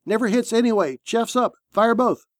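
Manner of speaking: high, tense command; urgent overruling. A narrator's reading. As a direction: cut off the objection and order fire. Commander overrules gunner in battle.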